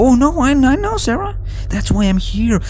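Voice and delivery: high falsetto, mock-sweet demon reassuring Sarah; mock Malik voice. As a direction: pitch it way up, fake-kind and syrupy. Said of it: "And Malik's like".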